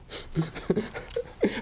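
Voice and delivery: breathless high silent laugh. Real, not acted. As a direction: wheeze with laughter. Laughing at the chat.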